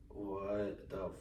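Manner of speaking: retold slow-motion bewilderment; stunned disbelief. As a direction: draw it out, quietly stunned. Stunned at auntie's episode.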